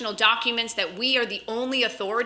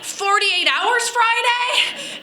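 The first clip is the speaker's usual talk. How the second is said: incredulous question; 9 semitones higher, much slower than usual. Retold or imitated. Husband stunned by short notice.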